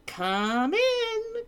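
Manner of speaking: very high drawn-out call; sing-song king. Acted. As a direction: sing the invitation out. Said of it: "cried the king".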